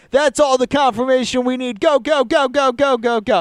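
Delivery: hammed-up go go go. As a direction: yell go go go like a reckless agent sending in the team. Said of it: "terrible F B I coworker is like".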